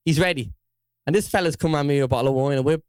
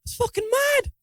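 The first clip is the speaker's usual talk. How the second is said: exclaiming. Real, not acted.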